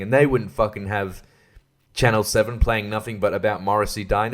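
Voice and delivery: ordinary talk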